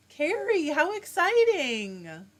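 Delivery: gasping, high and thrilled; light up with a gasp of delight; excited gasp. Real, not acted. Gasps at viewer's family news.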